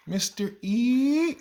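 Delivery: teacher mimics student calling his name, high lilting rise; imitating her sing-song. Mimicking student saying his name, drawn-out high rise. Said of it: "That's how she says my name".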